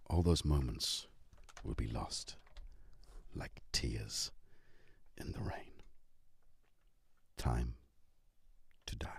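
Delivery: low, soft, fading recital broken by long pauses; dramatic monologue. Acted. Speaker ends the speech on time to die, hushed solemn monologue.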